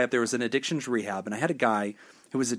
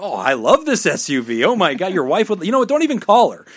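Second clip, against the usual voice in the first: much higher and louder, a hammed-up gushing woman; gushing saleswoman; 4 semitones higher, 8 dB louder than usual. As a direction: sell it with over-the-top delight. Give us a female perspective.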